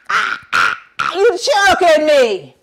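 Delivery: loud, high strangled cries; high, loud choked shouting; choking holler. Acted. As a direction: yell as if being choked. Buzzard hollers while choked.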